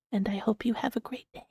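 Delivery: soft unvoiced whisper. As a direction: breathe it out in a whisper. ASMR affirmation to a friend.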